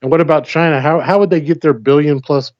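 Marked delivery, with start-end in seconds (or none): none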